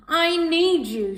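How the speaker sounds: high, loud and slow plea; cat pleading with Trendy Tessa; urgent. Acted. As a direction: beg urgently for help.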